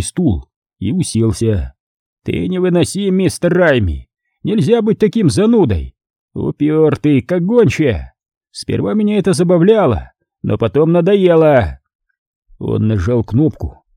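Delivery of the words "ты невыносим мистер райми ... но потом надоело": high-pitched, chiding cardinal; exasperated cardinal. Acted. Cardinal calling his captive a tiresome bore, high-voiced.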